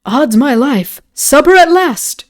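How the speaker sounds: jovial cry rising high and loud; merry exclamation. A narrator's reading. Lord greeting the soup at last, merry outburst. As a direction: exclaim heartily, bigger on the second phrase. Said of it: "ejaculated Lord Antony".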